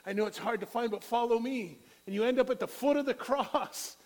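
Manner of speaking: preaching at full force. Acted. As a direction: swell it into a shout. Preaching climax.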